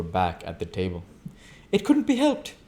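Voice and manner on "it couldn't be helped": high, bright-forced cry of cheer; Daisy crying out with forced cheer; tense gaiety. Acted. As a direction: cover the strain with high gaiety. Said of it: "cried Daisy with a tense gaiety".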